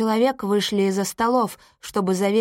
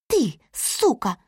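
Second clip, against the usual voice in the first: крик; 3 semitones higher, slower than usual. A narrator's reading. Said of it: "закричала она".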